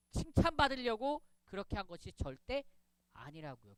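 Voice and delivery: usual speaking voice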